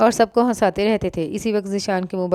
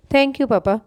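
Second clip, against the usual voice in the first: happy thanks; 6 semitones higher, slower than usual. A narrator's reading. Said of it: "उजाला ने खुश होकर कहा".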